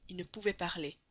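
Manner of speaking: ordinary delivery, nothing marked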